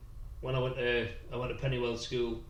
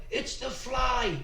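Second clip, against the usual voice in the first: high, drawn-out cry of recognition; excited call-out; 10 semitones higher, slower than usual. Retold or imitated. Schoolmate's dad spotting the Fly, excited high call.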